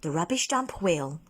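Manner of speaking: plain reading voice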